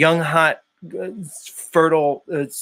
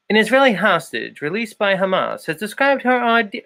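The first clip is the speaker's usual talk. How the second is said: higher newsreel announcer read; old-timey news broadcaster; 4 semitones higher than usual. Acted. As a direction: read like a 1950s newsreel narrator. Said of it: "read the news in my old-timey, news broadcaster voice".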